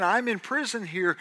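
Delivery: ordinary talk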